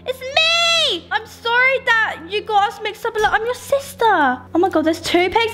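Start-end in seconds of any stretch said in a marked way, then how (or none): 0.1-4.1 high, eager little pig voice calling its sister; excited pig sister. Acted. Pig character finding her sister, high eager voice. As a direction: greet her like a long-lost sibling.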